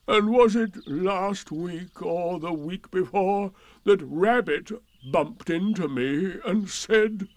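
slow, drawn-out martyred donkey; laboured, slightly high gloomy declaiming; self-pitying Eeyore. A narrator's reading. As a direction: complain in long-suffering, stretched phrases.

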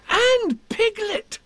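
thrilled, stretch both words up high; excited. Acted. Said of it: "said Christopher Robin excitedly".

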